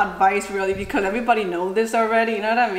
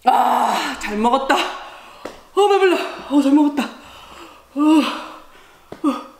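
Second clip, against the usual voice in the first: lightly voiced old man after a meal, grunting; obnoxious old Korean man; 2 semitones higher, much faster than usual. Shown as a demonstration. Showing how old Korean men finish a meal, 만족한 아저씨 흉내. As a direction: belch out satisfaction like an uncle. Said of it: "how old Korean men finishes off their meal".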